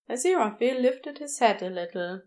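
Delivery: ordinary delivery, nothing marked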